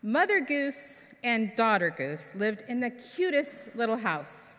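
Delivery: ordinary delivery, nothing marked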